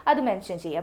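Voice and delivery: normal talking voice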